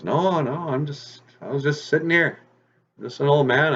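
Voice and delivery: put-on old man. Disguised rabbit answering the hunting fox, old-man voice. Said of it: "the old man goes".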